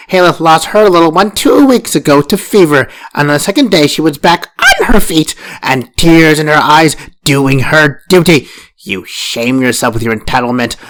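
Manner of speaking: loud contemptuous tirade; berating. Acted. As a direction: shame her with every word. First wife berating her.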